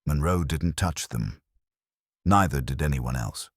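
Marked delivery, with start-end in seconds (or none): none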